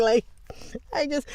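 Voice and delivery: ordinary talk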